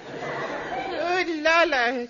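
slow, drawn-out French "oh la la"; hammed-up French lady; French accent. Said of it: "she said".

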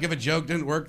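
ordinary delivery

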